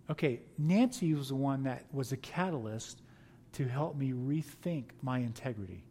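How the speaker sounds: usual speaking voice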